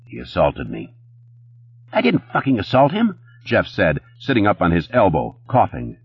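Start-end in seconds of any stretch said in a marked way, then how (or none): 0.0-0.9 low, calm statement; composed and understated. A narrator's reading. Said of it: "Connor said calmly". 1.9-3.2 high-pitched, outraged denial; aggrieved, voice pitched right up; indignant protest. A narrator's reading. Accused bouncer protests from the floor.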